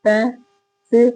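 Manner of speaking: Passive slowly broken into separate syllables. Shown as a demonstration. Teacher counting syllables in expensive, passive slowly split up. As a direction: sound out passive piece by piece, with gaps. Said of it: "fourth syllable आ रहे हैं इसमें".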